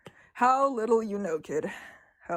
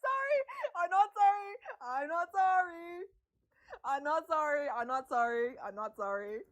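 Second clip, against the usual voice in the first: laughing hard; 5 semitones higher, 5 dB quieter than usual. Real, not acted. Streamer apologising to viewers unrepentantly, through real laughter. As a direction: squeak it out through laughter, winding down. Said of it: "I'm laughing so hard".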